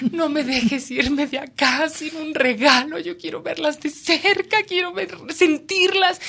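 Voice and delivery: high, slow pleading to the ocean god; pleading prayer. Retold or imitated. Reporter praying to the ocean god, high slow plea. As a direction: beg the sea for one gift. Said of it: "hablaba con el dios del océano".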